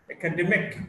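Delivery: academic as aca-DEM-ic; academic, weight on the third syllable; shifting-stress demo. Shown as a demonstration. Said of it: "stress shifts depending on the morphological structure".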